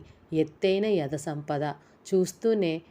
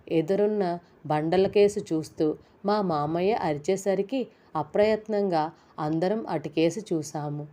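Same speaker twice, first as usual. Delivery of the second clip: ordinary delivery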